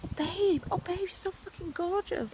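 high, sugary fake praise; mock gushing compliment. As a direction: gush like a fawning fan. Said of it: "everyone's gonna be like".

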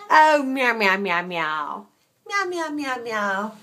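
playful drawn-out cat meows; mock meowing. Answering a cranky kitten, mock meowing. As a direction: imitate a complaining cat, pitch swooping.

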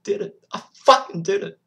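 loud, high confession, building to a shout; explosive cry of admission; anguished outburst. Acted. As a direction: blurt it out, then shout it.

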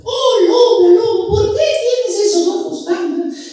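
put-on very high sing-song little-girl voice; Caperucita. Asking the wolf about big ears, sing-song falsetto. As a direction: squeal it in drawn-out falsetto.

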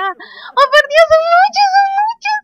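high, loud squealing; overexcited. Real, not acted. Gamer finally sees the aliens, squealing with excitement. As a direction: exclaim breathlessly over and over, overwhelmed with joy. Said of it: "me emocioné muchísimo".

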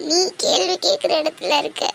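ordinary talk, nothing marked

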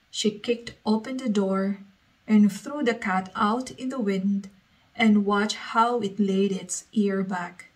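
ordinary delivery, nothing marked

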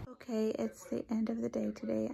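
hushed, tired end of day; whispering the day to a close. Real, not acted.

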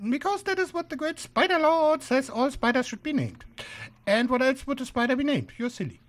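high, matter-of-course spider preacher; high-pitched old spider. Acted. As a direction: state the obvious in a high voice. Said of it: "shrill, high-pitched voice sort of spoils the effect".

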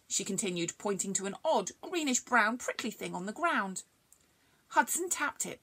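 ordinary delivery, nothing marked

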